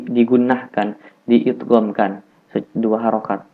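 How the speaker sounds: ordinary talk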